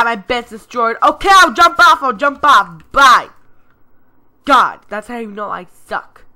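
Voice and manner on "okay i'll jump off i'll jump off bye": panicked yell. Real, not acted. Panicked jump off.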